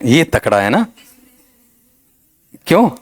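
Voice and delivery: usual speaking voice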